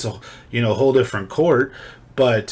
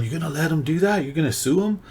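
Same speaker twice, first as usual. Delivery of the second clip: higher-pitched goading questions, imitating a friend; lightly mimicked needling friend; egging on; 5 semitones higher, faster than usual. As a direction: prod them into it with rising questions. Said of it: "He kinda prodded me and egged me on".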